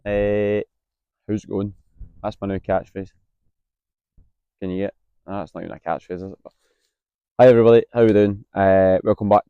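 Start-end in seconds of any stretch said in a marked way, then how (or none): none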